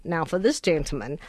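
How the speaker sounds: ordinary delivery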